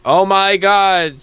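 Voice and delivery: usual speaking voice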